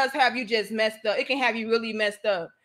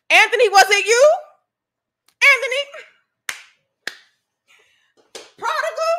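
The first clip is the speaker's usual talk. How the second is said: high, loud sing-song calling of the name; high teasing shout-out; 10 semitones higher, 8 dB louder than usual. Real, not acted. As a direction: call him out playfully.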